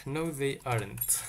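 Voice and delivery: ordinary talk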